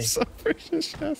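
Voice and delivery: laughing. Real, not acted. Repeating the insult to his partner, laughing. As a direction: laugh the words out in disbelief.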